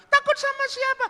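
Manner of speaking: loud, very high falsetto; insistent woman's voice. Acted. Woman pressing visitor for his name, loud falsetto. Woman challenges frightened Burhan.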